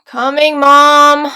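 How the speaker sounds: very loud drawn-out yell; calling back. Acted. Daughter answers mother's dinner call, yelling back. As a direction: yell it through the house.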